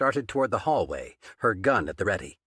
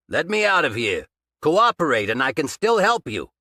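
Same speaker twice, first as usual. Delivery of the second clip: loud, higher demand through the door; urgent shout; 5 semitones higher, 6 dB louder than usual. A narrator's reading. Trapped detective pleading through door.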